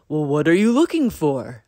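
cardinal voice. A narrator's reading. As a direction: call down from the branch. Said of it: "asked Cardinal".

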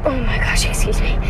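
talking through hiccups; breathy hiccup interrupting words. Real, not acted. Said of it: "I have the hiccups".

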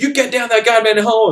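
high-pitched imitation of a commanding officer; angry general. General orders the scout into the bunker, angry.

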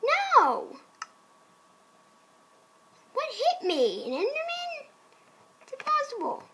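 high, baffled outcry; startled disbelief. Pretend. Player hit by an Enderman, startled and baffled. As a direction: cry out in rising alarm. Hit by unseen thing in game.

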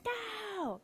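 put-on high-pitched, eager no from a child; children answering their Sunday school teacher; eager kids' no. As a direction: play the eager little kids answering. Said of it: "very enthusiastically".

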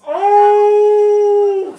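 sudden very loud high "Oh!"; startled cry. Real, not acted. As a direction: yelp as you catch your own slip. Catches himself swearing.

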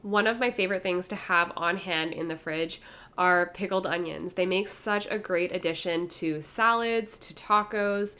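ordinary talk

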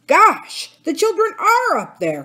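high, loud exclamation; startled cry. A narrator's reading. Jake spotting the children up the shaft, startled cry. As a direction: cry out in alarm. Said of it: "cried Jake".

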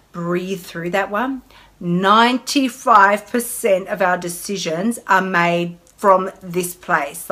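slow, weighty emphasis. Shown as a demonstration. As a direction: let it sink in slowly. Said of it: "Can we just breathe".

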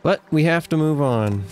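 ordinary talk, nothing marked

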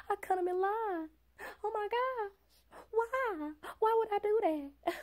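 high, half-joking anguish over cutting in line; mock remorseful wail. Mocking herself crying at night over the poor man in line, hammed-up high wail. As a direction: ham up the guilt, pitched high. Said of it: "and I will be crying".

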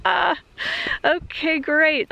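high, deflated fake cheer; sarcastic. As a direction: chirp it like bad news. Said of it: "It's just like".

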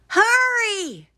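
very high-pitched urging; urgent Frog. A narrator's reading. Frog urging Toad after the list, urgent. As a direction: press them urgently in a high voice.

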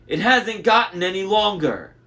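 high, forceful complaint; complaining monster. A narrator's reading. Monster inspects the weaving, complaining.

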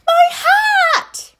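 high, loud startled cry; shocked shout. Acted. Townsperson yelling at the porridge flood in shock. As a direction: yelp in disbelief.

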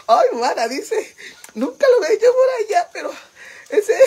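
high squeal through helpless laughter; laughing quote. Retold or imitated. Retelling a friend's embarrassed confession, laughing squeal. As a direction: voice the friend while cracking up, pitch soaring.

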